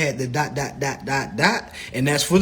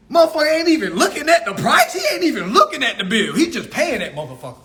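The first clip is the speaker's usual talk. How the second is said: laughing; 6 semitones higher, faster than usual. Real, not acted. Laughing at careless bill payers.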